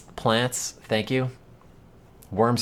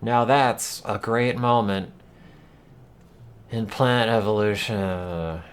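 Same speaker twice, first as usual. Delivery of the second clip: clickbait video narrator; 5 semitones higher than usual. Acted. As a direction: narrate like an over-the-top nature video. Parodies a dramatic plant-evolution video.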